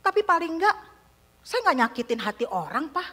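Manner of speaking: high, loud accusing son's voice; son's angry outburst. Retold or imitated. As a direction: hurl it at the father, hurt and furious. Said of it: "anak saya jawab begini". (anak marah berteriak kepada ayahnya, suara tinggi)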